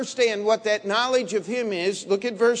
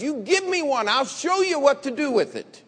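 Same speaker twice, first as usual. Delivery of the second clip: retold challenge; 7 semitones higher, 4 dB louder than usual. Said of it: "I said".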